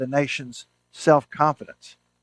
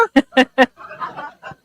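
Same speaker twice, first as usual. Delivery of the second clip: hearty laugh; 12 semitones higher, 6 dB louder than usual. Real, not acted. Speaker laughs at punchline.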